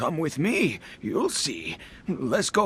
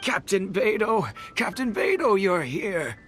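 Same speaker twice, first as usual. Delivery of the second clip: higher-pitched, eager calling out; excited hail; 4 semitones higher than usual. Acted. Villager rushing over to the captain for help, excited.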